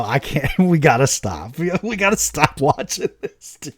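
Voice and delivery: breaking up with laughter mid-line; can barely get the words out for laughing. Retold or imitated. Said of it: "And I go".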